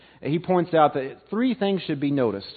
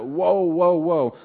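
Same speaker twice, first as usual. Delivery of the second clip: each WOE stressed, the first raised in pitch; emphatic repetition; 3 semitones higher, much slower than usual. Retold or imitated. Preacher showing why one woe was not enough, emphatic. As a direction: press on WOE each time, easing slightly by the last. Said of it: "we emphasize it".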